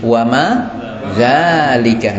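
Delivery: Ma hadza wa ma dzalika spaced out slowly; slow question reading. Shown as a demonstration. Teacher builds the question for the students, slowly. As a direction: lay out the question word by word. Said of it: "Nah, ma hadza wa ma dzalika".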